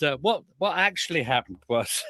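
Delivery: ordinary talk, nothing marked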